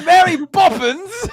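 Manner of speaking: loud, high, drawn-out British exclamation; British accent shout. Acted. Friends trading British catchphrases, hammed-up British shout.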